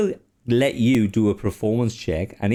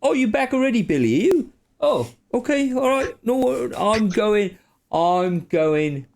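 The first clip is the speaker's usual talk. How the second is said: high-pitched, flustered character voice; confused; 10 semitones higher than usual. Acted. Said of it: "confused and goes".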